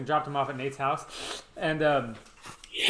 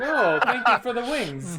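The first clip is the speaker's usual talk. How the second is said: put-on elderly neighbor voice; 7 semitones higher, 6 dB louder, slower than usual. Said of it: "be like".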